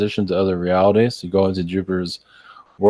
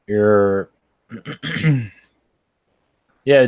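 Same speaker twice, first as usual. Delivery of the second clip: usual speaking voice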